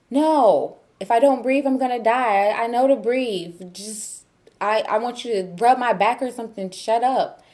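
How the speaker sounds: fed-up outburst. Retold or imitated. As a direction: act out losing patience with husband. Retelling quarrel with husband in labor.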